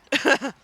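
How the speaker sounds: quick, high-pitched chuckle; short laugh. Real, not acted. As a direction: let out a brief, amused laugh. Laughs at modelling own cat.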